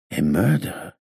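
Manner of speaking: put-on accented question, hushed surprise; disappointed echo. A narrator's reading. Gangster hearing of the murder, disappointed accented echo. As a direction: repeat it as if let down, in a foreign accent. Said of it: "as if disappointed".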